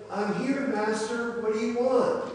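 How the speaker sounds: high, loud eager answer; eager young servant. Acted. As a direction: answer like a boy who has come running. Young Samuel runs to Eli.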